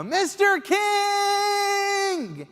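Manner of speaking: long drawn-out scream of a name, high and loud; desperate yell after a fleeing celebrity; top-of-lungs yell. Retold or imitated. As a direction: bellow it across a parking lot. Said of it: "yelling uncontrollably at the top of my lungs".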